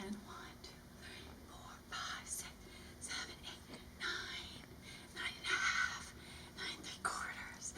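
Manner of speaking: whispered slow count. Acted. Whispered count while holding.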